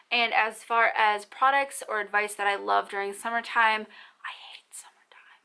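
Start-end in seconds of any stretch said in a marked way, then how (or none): 4.2-5.4 barely audible, voiceless whisper; whispered confession. Real, not acted. Mom confessing to viewers she hates summertime, whispered. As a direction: whisper it like a guilty secret.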